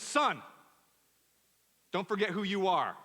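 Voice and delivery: put-on mother warning her twelve-year-old son, mildly stern. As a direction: remind him firmly, as his mother. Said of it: "She says".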